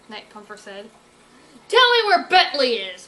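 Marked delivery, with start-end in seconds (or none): 1.7-3.1 loud, high demand. Acted. Berkeley demanding the villain give up Bentley, loud and high. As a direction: insist on an answer, loud. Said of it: "Berkeley demanded".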